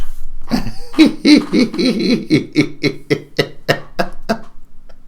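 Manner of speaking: high giggle running out of air; long, helpless giggle; cracking up. Real, not acted. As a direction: laugh high and long until breathless. Cracks up at own character.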